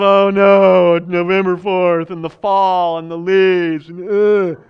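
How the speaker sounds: slow, lightly voiced lament; a touch of dreary gloom; half-hearted moaning about fall; mock mourning.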